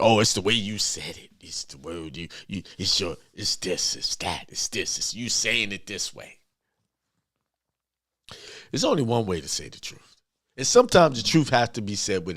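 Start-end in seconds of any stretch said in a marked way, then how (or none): 0.0-6.4 mock offended complaint. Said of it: "get upset or get offended".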